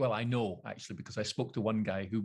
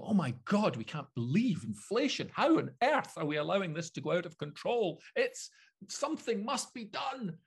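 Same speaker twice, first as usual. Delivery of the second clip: high-pitched, hammed-up alarm; mock outrage; 6 semitones higher than usual. Host parodies TV talking heads reacting to inflation, mock outrage. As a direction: melodramatic pundit panic, lurching higher.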